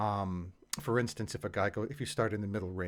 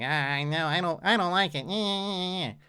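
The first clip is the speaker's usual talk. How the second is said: put-on whiny kid voice; 7 semitones higher, 6 dB louder than usual. Shown as a demonstration. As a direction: whine it like a sulky kid. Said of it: "like, a whiny kid voice".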